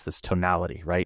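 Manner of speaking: ordinary talk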